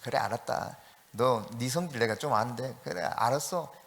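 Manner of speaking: low, soft placating murmur; soothing. Acted. God humouring someone like Jonah, soothing. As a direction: humour a sulker gently. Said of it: "요나처럼 막 달래주면서".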